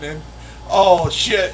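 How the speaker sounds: usual speaking voice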